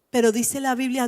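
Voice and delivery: normal talking voice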